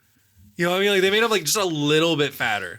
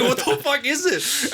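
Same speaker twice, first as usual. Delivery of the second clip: mock baffled; 13 semitones higher, slower than usual. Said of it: "I'm like".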